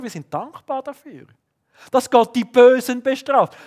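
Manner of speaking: mock self-satisfied piety, pushed up high; ironic emphasis. Ironische Steigerung der Predigt. (ironisch hoch und laut gesteigert)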